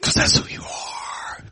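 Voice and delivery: slow, intense whisper; hushed affirmation. Real, not acted. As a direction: whisper it slowly to the congregation. Hushed follow-up to the charge.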